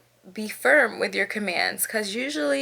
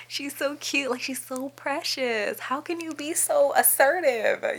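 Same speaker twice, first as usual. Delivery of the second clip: high, soft cooing over a puppy; melting at how precious she is; gushing; 5 semitones higher, 3 dB quieter than usual. Acted. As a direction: coo sweetly in a raised, tender voice. Gushing over cute puppies.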